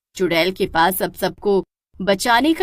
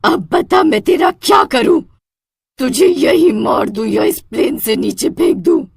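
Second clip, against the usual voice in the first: higher, louder menacing witch; witch threatening; 6 semitones higher, 6 dB louder than usual. Acted. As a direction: taunt the cowering man.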